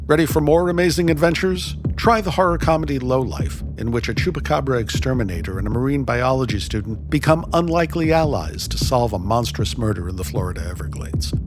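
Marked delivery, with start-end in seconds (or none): none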